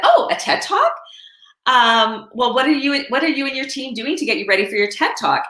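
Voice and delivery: high-pitched retold surprise, easing as the question goes on; impressed surprise. Woman asking author about her TED Talk, mildly surprised. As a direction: imitate an impressed acquaintance, starting very high.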